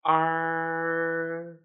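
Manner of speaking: "R" as "uh" with tongue tip curled back; long, steady "R" sound, tongue curled up and back. Shown as a demonstration. Teacher models for students, long curled-tongue "R". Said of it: "curl the tip of your tongue up and back".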